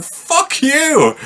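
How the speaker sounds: high-pitched mock outrage; indignant retort. Retold defiant reply to bank.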